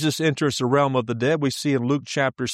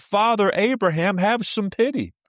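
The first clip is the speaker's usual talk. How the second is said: raised, higher plea to Abraham; shouting; 6 semitones higher, 4 dB louder than usual. A narrator's reading. Rich man in torment shouting up to Abraham, begging. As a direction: call out high and loud, begging. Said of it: "The rich man shouted".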